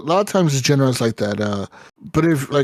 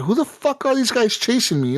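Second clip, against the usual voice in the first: incredulous outburst, high; 8 semitones higher than usual. Retold or imitated. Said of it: "and I'm like".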